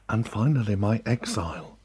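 plain reading voice

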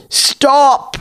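high sharp command to halt the brawl; commanding stop. Acted. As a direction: cut the fight dead with one cry. Hag Queen halts the fight.

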